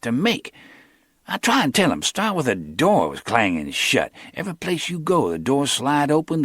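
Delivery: lightly voiced young hustler; Cornell's voice.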